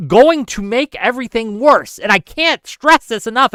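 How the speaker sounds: pleading, getting emotional; passionate. Real, not acted. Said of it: "I'm getting a little emotional right now, a little passionate".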